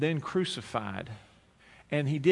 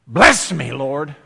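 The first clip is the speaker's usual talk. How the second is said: pleading prayer; 13 dB louder, much slower than usual. Retold or imitated. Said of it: "you're thinking".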